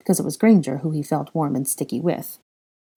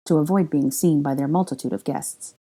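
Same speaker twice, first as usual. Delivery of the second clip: ordinary delivery, nothing marked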